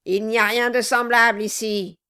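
loud, curt exclamation; crying out. A narrator's reading. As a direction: cry it out as the dwarf. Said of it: "cria le nain".

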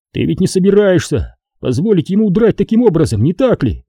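high-pitched reckless protest, no real yell; reckless cry. A narrator's reading. Obi-Wan feigning recklessness to Qui-Gon, crying out. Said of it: "заорал Оби-Ван с безрассудством в голосе".

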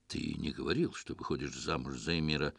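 low, soft, through the teeth; quiet hiss. A narrator's reading. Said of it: "тихо процедил Дамуло".

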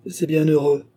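mild grumble, pitched high; grumbling. A narrator's reading. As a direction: grumble it as a grudging retort. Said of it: "grommela le sergent".

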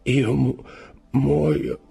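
high broken cry, cut off; the abbé collapsing as he speaks; dying gasp. A narrator's reading. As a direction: choke on the word mid-syllable. Faria struck mid-word.

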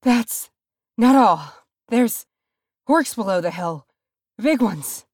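breathless, high, broken phrases; panting. A narrator's reading. Dwarf runner warning of orcs, panting. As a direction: gasp the warning out in bursts. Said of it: "Nori panted".

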